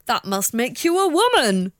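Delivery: high gasping mock outrage; mock shocked prude. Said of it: "and they're like".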